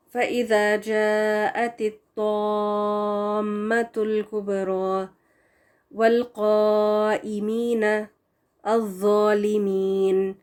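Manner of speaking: slow recitation with heavy ṭa and ẓa; Quranic recitation. Shown as a demonstration. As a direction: recite the verse with full heavy letters. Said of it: "پھر ہے تو کے ساتھ".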